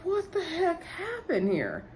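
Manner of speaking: high, hushed, drawn-out bewilderment; baffled disbelief. Retold or imitated. Recapper reacting to the whole situation, high hushed disbelief. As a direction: stretch it out high and soft, mock stunned.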